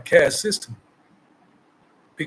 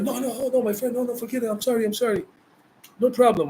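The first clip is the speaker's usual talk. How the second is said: hammed-up apologetic voice, much higher; mock pleading men; 7 semitones higher, faster than usual. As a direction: mimic men backing off in a hurry. Men backing down, voiced in story.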